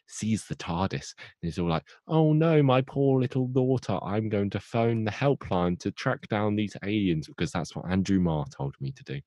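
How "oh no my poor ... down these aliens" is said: high, hammed-up fretting; mock motherly distress. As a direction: play a melodramatic worried mother. Acting the mother's panic over daughter.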